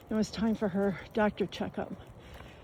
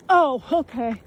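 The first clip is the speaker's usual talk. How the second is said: louder friendly aside to a passer-by; calling to passer-by; 3 semitones higher, 6 dB louder than usual. Real, not acted. Narrator to a passer-by with a bike, calling out. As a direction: break off and call out a compliment.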